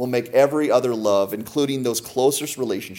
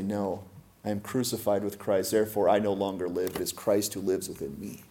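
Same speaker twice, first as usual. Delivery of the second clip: softer, low, firm declaration; hushed, settled answer of faith; quiet resolve; 3 semitones lower, 8 dB quieter than usual. Acted. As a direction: say it softly like a vow. Believer's quiet resolute reply.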